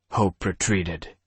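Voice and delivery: plain reading voice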